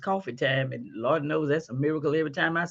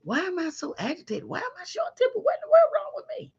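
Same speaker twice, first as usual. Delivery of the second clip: much higher and rising, ending in a fast whisper; mock frantic self-questioning; 13 semitones higher, 4 dB quieter, faster than usual. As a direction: act out a confused frazzled person talking to herself. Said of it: "you don't understand".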